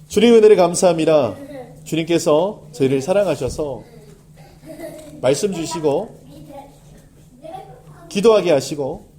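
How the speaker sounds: earnest, swelling pastor's prayer; closing prayer. Acted. Pastor leading the congregation in closing prayer, fervent. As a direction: pray it out, rising in fervor.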